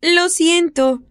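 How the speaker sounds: put-on high, eager child calling out; little girl voice. Little girl calls the older woman about the cat, high child voice. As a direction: play a worried little girl.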